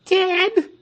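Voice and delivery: "Dead?" very high, loud, rising in disbelief; "Dead?" as if you can't believe it; shocked question. Acted. Crowd asking the pastor "Dead?" in high disbelief. Crowd hears the Emperor died.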